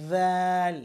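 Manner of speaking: দাল as long, loud "thaal", th voiced as in "this"; tongue on teeth tips, stretch দাল loudly; tongue-tip dh demo. Shown as a demonstration. Teacher modelling letter dhal, loud voiced th. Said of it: "আগার সাথে লাগিয়ে".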